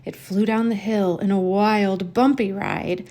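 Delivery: usual speaking voice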